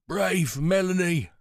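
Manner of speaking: put-on confused old warrior praising her; frail old man. A narrator's reading. Pritch voiced by narrator.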